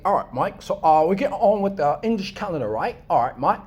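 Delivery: mock English accent. Shown as a demonstration. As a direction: poke gentle fun with an English accent. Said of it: "trying to speak like an English accent".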